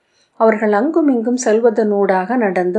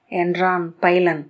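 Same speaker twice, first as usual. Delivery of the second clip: plain reading voice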